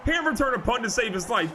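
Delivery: yelling over the interception, stretching the name out; ecstatic shout. Real, not acted. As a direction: scream in disbelief at the pick.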